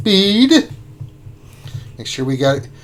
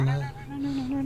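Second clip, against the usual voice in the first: high whine; 12 semitones higher, 12 dB quieter than usual. Real, not acted. Mod roll failing.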